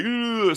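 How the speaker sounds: high strained groan of pain. Retold or imitated. Bullseye groaning after smashing his own face. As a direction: groan like teeth just got knocked out.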